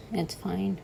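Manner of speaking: ordinary talk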